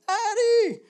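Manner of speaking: high, loud yell of a name; a child calling out in her sleep; retold yell. Dad retelling his daughter yelling a name in her sleep, loud high yell.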